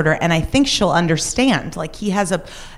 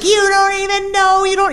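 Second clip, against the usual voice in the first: high, frantic imitation of a panicking man; mock hysterical, much higher; freaking out; 3 dB louder than usual. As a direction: squeak it out in panic, way up. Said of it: "he's just freaking out".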